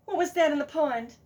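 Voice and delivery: ordinary delivery, nothing marked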